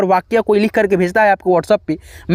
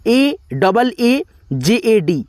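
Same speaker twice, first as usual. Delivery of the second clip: spelling out letters; much slower than usual. Shown as a demonstration. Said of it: "डी से दौ".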